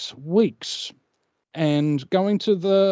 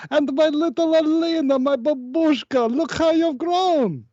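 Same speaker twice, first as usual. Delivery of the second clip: hammed-up doting father; 13 semitones higher than usual. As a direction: coo over the little girl theatrically. Greets daughter after jail.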